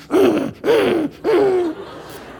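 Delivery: high growls in repeated bursts; imitated dog growls. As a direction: growl like a dog tugging a towel. Imitates dog tugging on towel.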